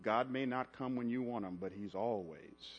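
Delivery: low, soft, intimate preaching voice; hushed sermon close. Real, not acted. Preacher softly reassuring the congregation, hushed and low. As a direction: draw the church in close and quiet.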